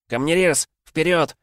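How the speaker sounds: high urgent call; shout. Acted. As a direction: yell him forward. Boy urges stonecutter on. (громкий призыв)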